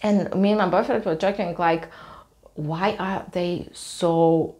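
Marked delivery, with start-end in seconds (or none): none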